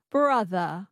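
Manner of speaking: BROTHER with a schwa for the final R; dropped R. Shown as a demonstration. Student echoing coach, brother without R. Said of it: "you drop the R and you make it a schwa".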